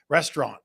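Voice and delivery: restaurant said as "restrahn", middle vowel dropped, no final t; his own way of saying restaurant. Shown as a demonstration. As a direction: say restaurant as "res-trahn", nasal ending. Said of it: "Do I say that word funny?".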